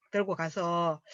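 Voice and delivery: ordinary talk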